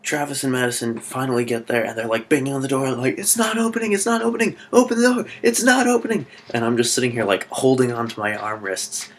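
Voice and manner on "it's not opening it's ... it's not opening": mock panic. Group banging on a locked door, in high mock panic. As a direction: play trapped and freaking out, voice way up. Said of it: "panicking and freaking out".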